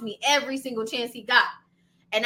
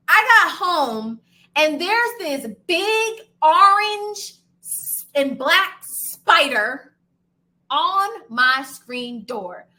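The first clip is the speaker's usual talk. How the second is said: dramatic slow build; 5 dB louder, much slower than usual. Real, not acted. Describing the scary spider.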